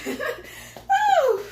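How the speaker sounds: high laughing woo; laughing whoop. Real, not acted. Laughing cheer mid-workout.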